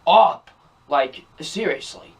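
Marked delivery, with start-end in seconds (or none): 0.1-0.5 exasperated, raised-pitch "shut ... up" with a punched UP; mock-snap it in two beats, loud on the last word; fed-up outburst aimed at a whiny character. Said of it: "I'm just like".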